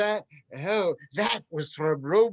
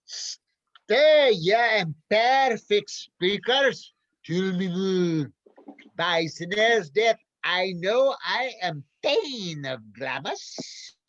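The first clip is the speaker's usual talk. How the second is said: a lightly put-on Scottish accent, booming like a stage king; actor's overdone Scottish accent on Macbeth's lines; bad Scottish accent; slower than usual. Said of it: "it's a Scottish accent".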